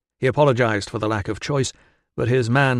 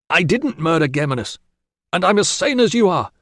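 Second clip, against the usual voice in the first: raised indignant protest; 7 semitones higher, 5 dB louder than usual. A narrator's reading. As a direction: insist hotly on innocence. Prisoner protests his sanity.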